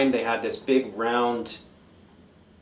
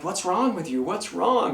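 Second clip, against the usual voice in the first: puzzled concern; 7 semitones higher than usual. Retold or imitated. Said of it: "And I'm like".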